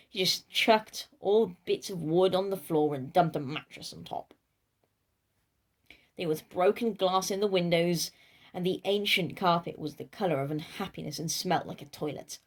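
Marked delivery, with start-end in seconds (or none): none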